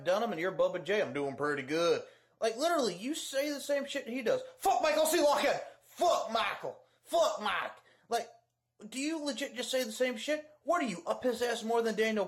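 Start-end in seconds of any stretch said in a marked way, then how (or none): 4.6-7.7 mock yell. Yelling mock insult at rival.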